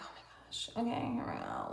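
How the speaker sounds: hushed eager whisper; whispering to herself; whispered quote. Retold or imitated. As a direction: breathe it out, barely voiced. Retold inner excitement, whispered.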